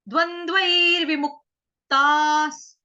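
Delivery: slow, loud recitation. Shown as a demonstration. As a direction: intone it steadily. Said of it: "आघात ... आधे क का".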